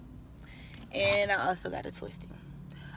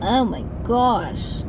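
awed; 3 semitones higher, 5 dB louder, much slower than usual. Real, not acted. Admiring aunt's house.

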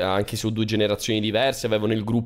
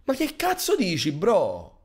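indignant retort; 7 semitones higher, slower than usual. Acted.

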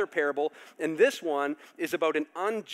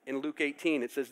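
ordinary talk

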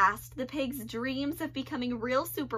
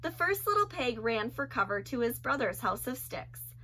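ordinary delivery, nothing marked

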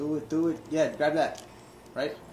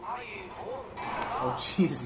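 usual speaking voice

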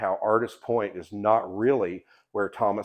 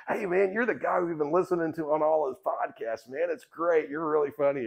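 put-on higher, eager young guy voice; excited young fan; 7 semitones higher than usual. Boyfriend recognizes the podcaster at the overlook, a higher eager fan voice. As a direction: play the boyfriend gushing over a favorite podcaster. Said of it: "her boyfriend spins around and he goes".

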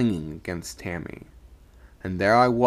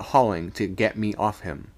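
plain reading voice

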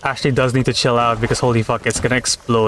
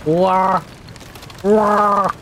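panicked wails; 7 semitones higher than usual. Real, not acted. Ship crashing.

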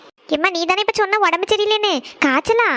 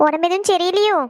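normal talking voice

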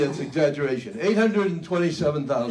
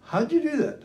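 pitched up high in disbelief; amazed asker; 12 semitones higher than usual. Retold or imitated. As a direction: ask it with wide-eyed wonder. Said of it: "The question I most frequently ask".

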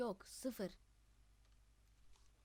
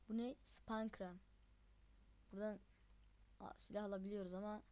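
normal talking voice

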